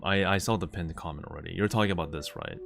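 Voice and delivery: quiet aside. Real, not acted.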